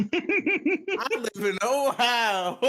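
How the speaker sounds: very slow, high Southern drawl broken by laughter; mock Southern accent, laughing. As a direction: laugh through a cheesy Southern line. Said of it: "classic Ohio accent".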